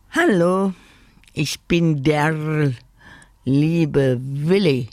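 slow croaky budgie voice; croaking bird. Acted. Willi croaks a hello to the old lady, slowly. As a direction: croak it out like a parrot. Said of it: "krächzte er".

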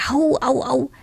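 high, whiny little witch going ow; a little witch moaning over a bump on her head; whimpering little witch. A narrator's reading. Little witch, after crashing through the window, whimpering. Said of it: "jammerte sie".

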